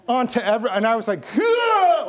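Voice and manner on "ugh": high, loud choked gasp; a choking grunt as she grabs him; strangled choking grunt. Retold or imitated. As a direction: sound like you're being throttled. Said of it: "like this".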